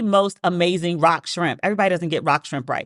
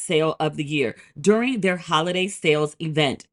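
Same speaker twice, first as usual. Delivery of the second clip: polished sponsor read. Acted. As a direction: sell the product smoothly, like a podcast host ad. Host reads sponsor ad.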